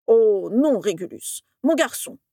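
put-on swooping, distressed old teacher; dismayed professor. A narrator's reading. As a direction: plead in dismay, pitch wavering widely. (professeur en plein désarroi)

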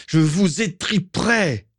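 slow, high-pitched furious cry; angry cry. A narrator's reading. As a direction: draw the threat out into a high cry. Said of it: "cria-t-il". (cri de rage étiré)

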